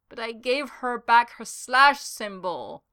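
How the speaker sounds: a defeated man moans to the room, slow and loud; moaning lament. Acted. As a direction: moan it out to nobody. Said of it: "he moaned to no one in particular".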